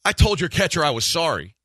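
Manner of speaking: arguing with the other coach, loud and fed up; exasperated retort. Retold or imitated. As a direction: throw it back at him, fed up. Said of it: "I said".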